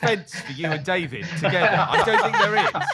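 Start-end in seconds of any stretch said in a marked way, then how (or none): 1.9-2.9 high, loud, laughing objection; amused exasperation. Real, not acted. Quiz host to fence-sitting panellists, amused exasperation. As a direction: push back in a comic high pitch.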